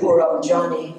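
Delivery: usual speaking voice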